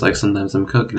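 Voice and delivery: ordinary talk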